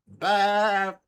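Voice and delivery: long, drawn-out ram bleat. Pretend. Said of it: "And finally, we have".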